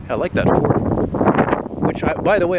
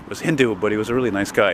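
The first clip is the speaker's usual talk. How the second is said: normal talking voice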